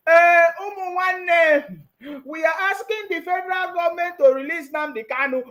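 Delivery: hammed-up high address to the crowd; mock press conference. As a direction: draw out the opening like a mock rallying speech. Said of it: "he will just come out and do a press conference".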